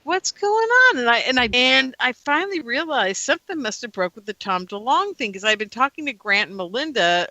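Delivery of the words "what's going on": high startled question; sudden shocked what's going on; surprised. Retold or imitated. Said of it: "I was like".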